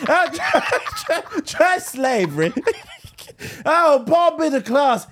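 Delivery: high, loud, laughing sarcasm; mock sarcastic nurse. Pretend nurse mocking a patient's pain, laughing and loud. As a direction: sneer through laughter at the patient.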